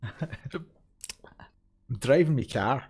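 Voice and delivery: put-on slow, drawn-out Belfast accent; northern Irish accent. Streamer having another go after doubting it, Belfast accent. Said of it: "It's northern anyway".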